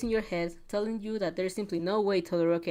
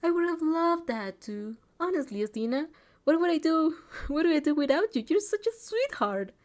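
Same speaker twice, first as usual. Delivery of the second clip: higher, lively voice of an affectionate friend; gushing friend; 7 semitones higher, 3 dB louder than usual. A narrator's reading. Friend thanks a would-be teammate, gushing. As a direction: gush at a dear friend, drawing out words.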